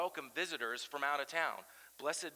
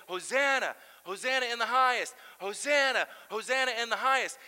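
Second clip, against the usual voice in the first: high, loud chant of "Hosanna"; yelling hosanna; 7 semitones higher, 10 dB louder than usual. Retold or imitated. One group on the roadside cheering Jesus, loud chant. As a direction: shout it like a cheering crowd. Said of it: "One is yelling".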